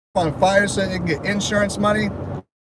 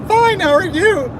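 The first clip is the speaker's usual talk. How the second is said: high, slow, put-on polite voice; fake polite reply; 13 semitones higher, slower than usual. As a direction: answer with forced niceness, up high. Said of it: "You're like".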